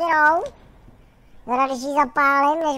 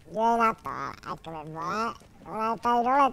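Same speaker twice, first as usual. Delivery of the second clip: ordinary talk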